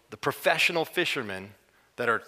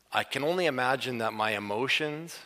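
ordinary talk, nothing marked

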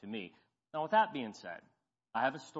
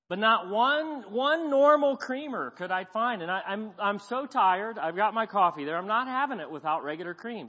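higher, louder, exasperated storytelling; mock exasperation; 6 semitones higher, 7 dB louder than usual. Preacher telling the church about missing creamer, exasperated. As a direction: play up the coffee-deprived grievance. Retelling his hunt for creamer.

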